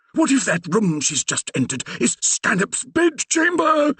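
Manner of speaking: put-on high, slow anxious old vicar; fretting that his daughter is in danger; fearful Reverend. A narrator's reading. The Reverend fearfully asking Percy about the bedchamber, anxious.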